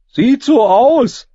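very high, loud, drawn-out reply; boy's character voice. A narrator's reading. Boy answers, joining the fight.

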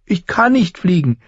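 high-pitched tearful denial; tearful protest. A narrator's reading. Boy tells teaching crow he cannot, tearful. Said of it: "unter Tränen".

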